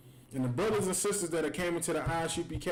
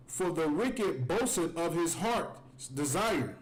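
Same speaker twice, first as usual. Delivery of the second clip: slow scripture reading; slower than usual. Acted. Reads scripture slowly.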